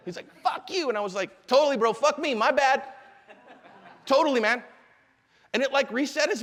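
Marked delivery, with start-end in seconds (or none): 0.4-0.9 high-pitched quick imitation of an insult; mock angry yell. Retelling the driver's curse to the audience, mock angry. 1.5-4.6 higher, louder mock-cheerful concession; over-eager agreement. Dad defusing the angry driver, over-eager agreement. Retelling his agreeable comeback.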